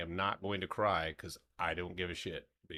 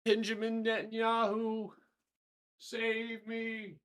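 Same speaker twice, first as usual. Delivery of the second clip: high mock plea; 12 semitones higher, slower than usual.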